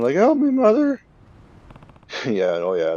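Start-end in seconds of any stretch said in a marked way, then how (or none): 0.2-1.0 put-on high, loud child's cry; high, loud help me from a kidnapped boy; mock boy's yell. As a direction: squeak it out like a scared kid. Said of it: "the boy's yelling".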